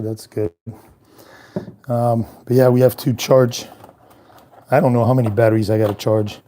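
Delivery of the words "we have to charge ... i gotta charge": ordinary talk, nothing marked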